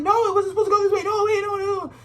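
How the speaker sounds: high, loud mock protest; panicky Scorpio. Reader voicing the Scorpio's panic, high and loud. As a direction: squeal it like a control freak losing grip. Scorpio resisting life's changes.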